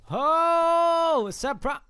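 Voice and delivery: high, loud, long-held whoop; gleeful surprise shout. Real, not acted. Player springing out on an enemy, whooping high. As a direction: sing out the ambush loudly.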